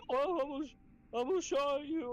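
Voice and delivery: high falsetto imitation of a trainee; retold startled trainee. Trainee who almost shot him, mock high-pitched alarm. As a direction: squeak it out as a mock near-miss confession.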